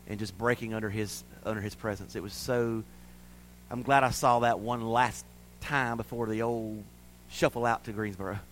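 hushed, low, reverent telling; tender. Real, not acted. Father recalling his daughter singing in church, tender. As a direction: drop right down, soft and moved.

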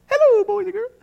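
high squeaky cartoon mouse greeting; Mickey Mouse voice. Mickey greeting arriving visitors, high cartoon voice. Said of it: "Mickey Mouse says".

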